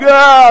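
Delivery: high loud cartoon ah; an emoji said out loud; mock exasperated ah. Shown as a demonstration. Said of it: "like emoticon".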